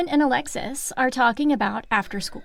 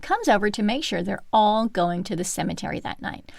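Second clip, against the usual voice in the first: ordinary delivery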